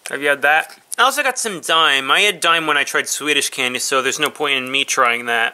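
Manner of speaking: speaking between bites; chewing while talking. Real, not acted. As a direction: mouth partly full of candy. Seen 1: Holds chocolate pops, puts one in mouth, talks while chewing.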